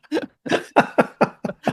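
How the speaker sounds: laughing. Real, not acted. Laughing at own impression.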